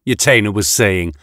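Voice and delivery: usual speaking voice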